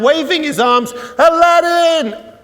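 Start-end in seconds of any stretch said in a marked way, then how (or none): 1.2-2.3 high, long-drawn shout for a hero; desperate shout. Acted. Kid shouts to his hero on the passing float, desperate shout. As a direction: yell it out, stretching the name. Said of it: "absolutely jumping up and down".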